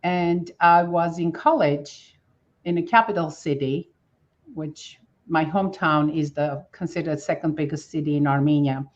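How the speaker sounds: ordinary talk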